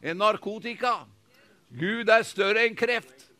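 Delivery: loud, rising preacher's proclamation; full-force preaching. Acted. Pastor proclaiming to the congregation, loud preaching. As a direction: preach it out to the whole hall.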